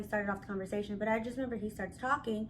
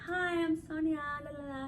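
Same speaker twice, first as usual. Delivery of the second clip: cutesy flirt; 5 semitones higher, 3 dB quieter than usual. Retold or imitated. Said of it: "play my little cuteness up".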